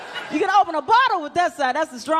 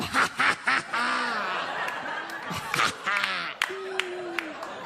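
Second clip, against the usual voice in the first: laugh behind hand; 6 dB quieter than usual. Shown as a demonstration. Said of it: "you always put that hand up".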